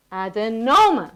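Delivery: "Adenoma" slowly, NO drawn out; stress on NO. Shown as a demonstration. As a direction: swell on the NO of "Adenoma". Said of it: "I wanna hear more of a no".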